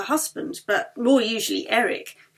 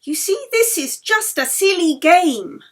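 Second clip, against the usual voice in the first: high, rising, louder protest; insistent; 7 semitones higher, 7 dB louder than usual. A narrator's reading. Yeli protesting to the circle, high and insistent. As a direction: brush it off with rising force. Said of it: "Yeli insisted".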